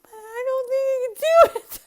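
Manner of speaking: very high, loud whiny squeal; hammed-up tearful protest; panicked mock wail. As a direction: whine it as if about to cry.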